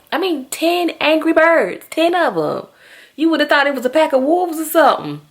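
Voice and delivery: high and animated, fast at the end; excited exaggeration. Real, not acted. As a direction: marvel at the chaos.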